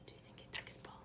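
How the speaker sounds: hushed suspense whisper. Acted. Teacher whispering a guess to the children. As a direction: lean in and whisper the question.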